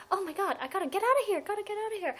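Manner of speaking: high, fast mock panic; panicked. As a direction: squeal it like you're fleeing in a hurry. Imagined self fleeing zombies.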